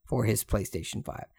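usual speaking voice

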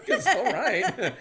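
words lost in a real laugh. Real, not acted. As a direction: laugh through it, breathless. Laughing at own joke.

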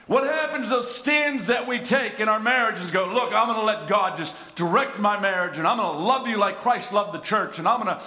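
high, loud declaring preacher; preacher driving the point home; preaching at full force. Real, not acted. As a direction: proclaim it with passion.

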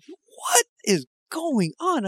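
surprised. Retold or imitated. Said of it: "I'm like".

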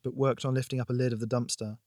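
ordinary delivery, nothing marked

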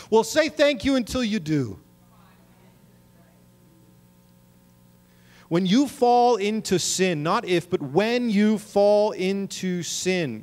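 loud, high, driving sermon delivery; impassioned preaching. Acted. Preacher urges the church to worship and be grateful, full-force exhortation.

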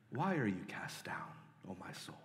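softly whispered to your own soul; whisper to yourself. Shown as a demonstration. Pastor preaching to his own soul, in a soft whisper. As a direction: breathe it out in a hushed self-talk whisper. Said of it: "I have to say it like this sometimes".

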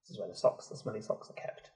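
hushed tour-guide whisper. Pretend. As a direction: breathe it like a secret. Mock tour of locker room.

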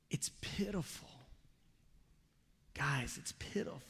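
soft whisper of pity; whispered lament. Retold or imitated. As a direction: breathe it out sadly and quietly. Jesus voiced lamenting their state.

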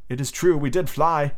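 plain reading voice

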